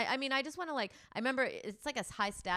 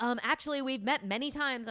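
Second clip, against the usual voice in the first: mock annoyed man; 5 semitones higher, 5 dB louder than usual. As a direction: correct her with polite irritation. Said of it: "That, like, angry".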